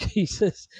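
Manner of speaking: usual speaking voice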